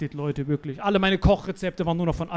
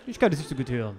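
ordinary delivery

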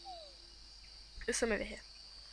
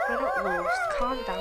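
high squeaky voice; 5 dB louder than usual. Real, not acted. Talking to creatures.

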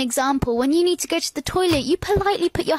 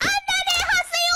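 exasperated; 14 semitones higher, faster than usual. Acted. Ranting at the advert.

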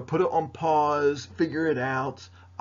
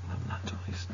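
whispered aside; 8 dB quieter than usual. Real, not acted. Muttered aside about mirror image.

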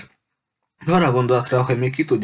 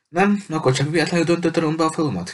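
sarcastic, pitched much higher; mocking; 7 semitones higher than usual. A narrator's reading. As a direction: scoff at the claim, higher. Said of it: "kérdezte gúnyosan Julia".

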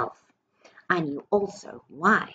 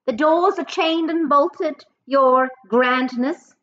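much higher, louder, slow on your grandness; a fawning witch answers her queen, pitched way up; high witch reply; 9 semitones higher, 7 dB louder, slower than usual. Acted. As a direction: witch in the audience answering her leader loudly. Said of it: "answered a voice in the audience".

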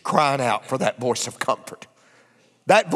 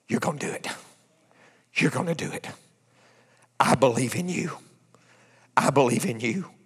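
soft encouragement; 2 semitones lower, 4 dB quieter than usual. Retold or imitated. Wife encouraging him, retold.

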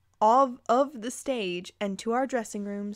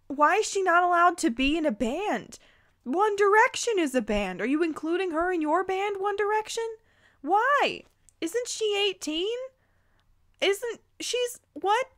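higher, incredulous questioning; baffled; 8 semitones higher than usual. Real, not acted. Reader baffled by the story, incredulous high questions. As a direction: ask in rising disbelief.

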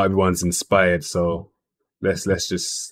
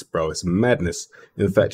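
ordinary talk, nothing marked

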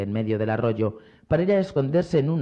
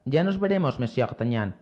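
plain reading voice

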